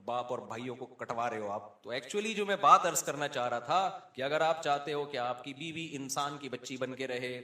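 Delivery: ordinary talk, nothing marked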